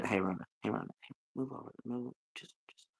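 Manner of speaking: low, quiet grumbling Muppet grouch; a low, quiet Sesame Street grouch nagging Rhonda. Retold or imitated. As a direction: growl her name low like a grumpy puppet. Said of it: "A green dude in a trench coat that would just be".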